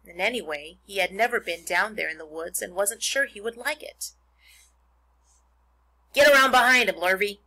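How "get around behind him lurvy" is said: loud, raised-pitch command to a farmhand; farmer giving orders. A narrator's reading. Mister Zuckerman directing his hired man after the escaped pig, loud orders. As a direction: call out instructions across the farmyard. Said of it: "said Mister Zuckerman".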